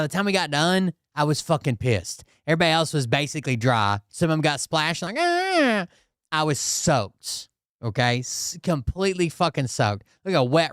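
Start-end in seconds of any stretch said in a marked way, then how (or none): 5.2-5.9 high-pitched mock "ah"; imitated squeal. Storyteller mimicking riders who got splashed, mock squeal. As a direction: squeal like someone lightly splashed.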